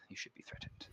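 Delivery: whispered threat; ominous whisper. Acted. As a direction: hiss it under your breath as a warning. DM ominous aside.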